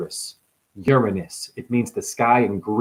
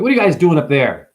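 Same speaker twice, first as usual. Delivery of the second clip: loud, quick mock scolding; calling up; 5 semitones higher, 6 dB louder, faster than usual. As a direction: call up to them in loud reproach. Acted shouting up at gods.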